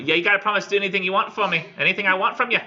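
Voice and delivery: high, loud, rapid Cockney hag. Acted. Hag pushes the knight for his promise, high loud Cockney. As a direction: rattle it off as a pushy Cockney crone. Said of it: "like, kinda Cockney accent".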